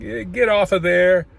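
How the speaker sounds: impatient boss taking over the job; bossy brush-off. Retold or imitated. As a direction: shoo them off, you'll do it yourself. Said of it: "I was like".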